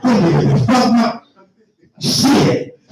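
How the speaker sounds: laughing, slow groan of disbelief; half-laughing at the wreck. Real, not acted. Checking the smashed car, laughing in disbelief.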